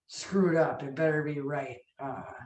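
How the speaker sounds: ordinary delivery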